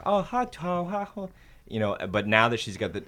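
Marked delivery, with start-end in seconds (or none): 0.1-1.3 put-on high babbling toddler voice; toddler babble. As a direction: imitate a baby's first sounds. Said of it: "she just kind of was like".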